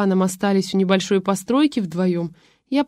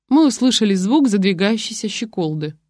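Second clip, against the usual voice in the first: ordinary delivery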